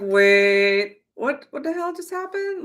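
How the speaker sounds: high, startled double take; sudden alarmed realization; shocked. Retold or imitated. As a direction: caught off guard, high and strange. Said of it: "I was like".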